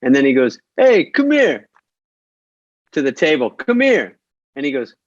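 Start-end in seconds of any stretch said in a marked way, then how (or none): 0.8-4.2 high mock call across a restaurant; calling over. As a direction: call him over from the table. Stranger calls Pesci over.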